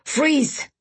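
high, sharp barked command. Acted. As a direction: bark it like a guard. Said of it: "a voice behind me barked".

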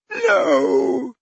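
high groaning refusal; groaned no. A narrator's reading. Dumbledore refusing the goblet, high groan. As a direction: groan it in despair. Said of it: "he groaned".

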